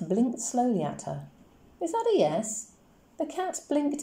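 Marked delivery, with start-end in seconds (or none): 1.8-2.7 put-on high, curious girl's voice; Coraline asking. A narrator's reading. Child Coraline asks the cat.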